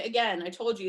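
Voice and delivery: ordinary talk, nothing marked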